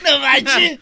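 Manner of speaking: laughing. Acted. Judas voiced laughing at Jesus.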